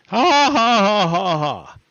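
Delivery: a cheeky villainous cackle, fading out; mock laugh. Teller taunting listeners after teasing the context, hammed-up laugh.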